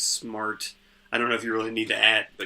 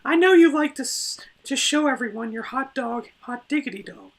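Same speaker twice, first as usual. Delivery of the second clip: much higher put-on voice; silly character voice; 10 semitones higher than usual. Co-host as princess scolding Greg in a put-on voice. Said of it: "your voice went into, like, this deep".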